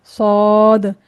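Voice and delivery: सौद drawn out, thick, no w glide; heavy sod. Shown as a demonstration. Teacher models the correct reading, long heavy सौद. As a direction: hold a full o colour in सौद. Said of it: "इसको पढ़ना है".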